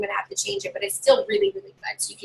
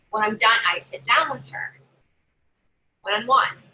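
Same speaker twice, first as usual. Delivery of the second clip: normal talking voice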